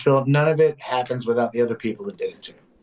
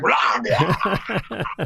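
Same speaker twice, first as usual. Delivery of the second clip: high mock stage roar; comic switched-on growling yell; 7 semitones higher than usual. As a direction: roar it out like a country rocker hitting the stage. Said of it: "He gets up on stage".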